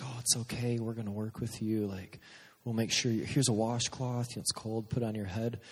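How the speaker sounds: hushed, coaxing retold comfort; soothing nurse. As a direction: imitate a nurse calming a nervous patient. Nurse soothing a nervous patient.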